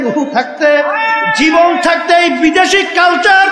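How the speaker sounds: high, forceful rallying sermon; impassioned preaching. Real, not acted. Preacher challenges congregation over their children, in high impassioned preaching. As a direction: build to a shouted challenge to the crowd.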